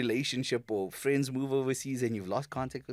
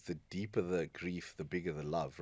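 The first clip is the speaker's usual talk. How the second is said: quoted saying, softly; 4 semitones lower, 7 dB quieter than usual. Retold or imitated. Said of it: "there's a phrase that goes".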